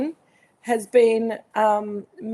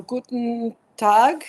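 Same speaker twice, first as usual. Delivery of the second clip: slow, put-on German greeting; German accent; 6 dB louder, much slower than usual. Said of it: "I do the accent very well".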